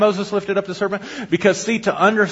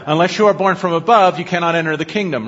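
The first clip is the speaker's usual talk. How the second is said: ordinary talk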